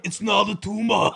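loud mock-Austrian movie catchphrase; Arnold Schwarzenegger. Podcast host quoting a missed movie line, Schwarzenegger impression. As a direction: shout it like an accented action hero. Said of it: "doing an Arnold Schwarzenegger impression".

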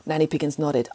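usual speaking voice

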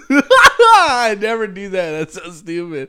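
laughing through the words; high, loud giggling denial. Real, not acted.

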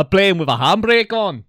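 loud, slow mock Scottish accent; drawn-out pundit impression. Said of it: "this is my Scottish accent".

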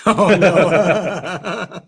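cracking up in disbelief; real burst of laughter. Real, not acted. Host hears the sermon mix-up.

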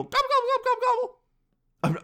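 shrill-high run of mimicked gobbles; very high turkey impersonation; imitated turkeys answering. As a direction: gobble back like a flock of turkeys. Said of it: "and they go".

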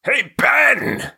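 high shouted cartoon call; Bony voice. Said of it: "Dave, you're bony".

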